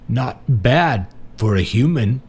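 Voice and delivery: slow, amused jibe; sniggering. A narrator's reading. Marty to his visitor at the hospital bed, sniggering. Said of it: "he sniggered".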